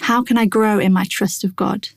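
normal talking voice

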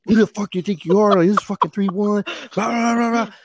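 high mock rant running into blah-blahs; imitated chewing-out. Corporal berates junior for his conduct, imitated high angry rant. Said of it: "he's just, like, chewing me out".

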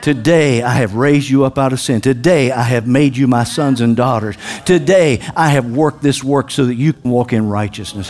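low, hushed voice of God; deep, measured divine pronouncement; God speaking. Acted. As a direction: declare it low and solemnly to your children. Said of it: "and he was saying".

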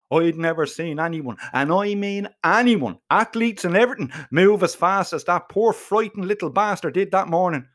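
ordinary delivery

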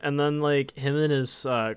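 ordinary talk, nothing marked

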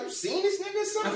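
high, fast accusing question; suspicious outburst. Retold or imitated. Accusing girlfriend of knowing the counselor, fast high accusation. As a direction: fire it off in disbelief.

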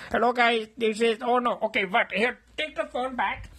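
loud greeting. Acted. Roommate grabs phone, greets viewers.

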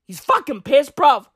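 normal talking voice

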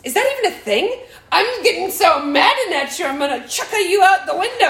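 a son teasing his mom in a store, laughing loudly; laughing kid tease. Retold or imitated. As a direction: play the kid cracking himself up. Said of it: "they're dying laughing".

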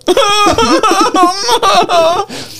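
high, bursting into laughter; words breaking into a long high laugh. Real, not acted. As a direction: praise him while cracking up. Mock praise dissolving into laughter.

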